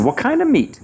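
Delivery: put-on high, curious child's question; a wide-eyed kid wanting to know; little boy voice. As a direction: grandson questioning his grandfather. Son asks grandfather.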